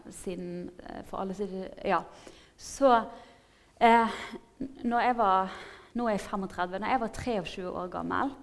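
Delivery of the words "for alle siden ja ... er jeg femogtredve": ordinary talk, nothing marked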